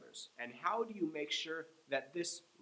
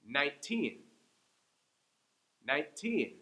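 put-on Nineteen with the teen stressed; nine-TEEN, second syllable strong. Shown as a demonstration. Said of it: "I'm saying each one twice".

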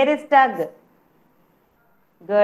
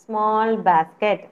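slow clear gloss; 2 semitones lower, much slower than usual. Shown as a demonstration. Teacher explaining the word to children, slow. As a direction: stretch each word out slowly. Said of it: "teacher gloss, slow".

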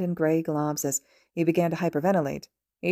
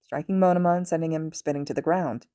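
ordinary delivery

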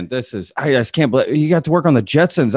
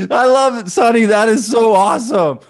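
delighted laughter; 9 semitones higher than usual. Real, not acted. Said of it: "host delighted by the impression".

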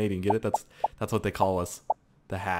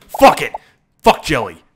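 loud burst of abandon; sudden shout; 12 dB louder than usual. Real, not acted. Gamer giving up sparing Jelly, sudden shout. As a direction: blurt it at full volume.

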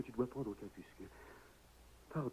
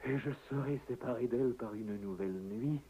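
usual speaking voice

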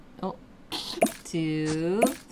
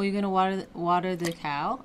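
ordinary delivery

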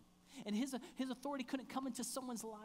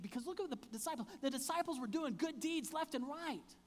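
rising fervour; 6 semitones higher, 5 dB louder than usual. Real, not acted. Preacher builds intensity.

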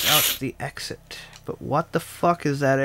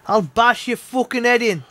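put-on higher London accent threat; 7 semitones higher than usual. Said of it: "nice accent".

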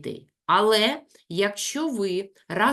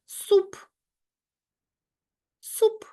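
«Суп» high and firm; syllable emphasis. Shown as a demonstration. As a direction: sound out «Суп» clearly. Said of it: "інтонаційно розділяючи склади". (чітко, наголошено «Суп»)

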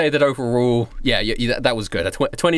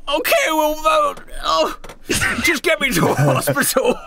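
panicked heart attack; 10 semitones higher, slower than usual. Acted. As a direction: cry out in pain and panic, pitched way up. NPC clutching heart, heart attack.

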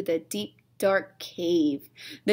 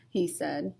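whispering; 4 semitones lower, 5 dB quieter than usual. A narrator's reading.

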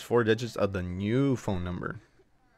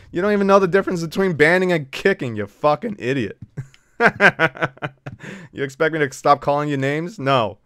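normal talking voice

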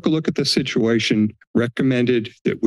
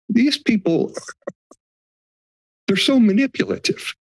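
higher-pitched, exasperated cry of disbelief; exasperated outburst; 10 semitones higher than usual. Real, not acted. Homeowner venting at supervisors about staff, higher exasperated outburst. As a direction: let it burst out in disbelief.

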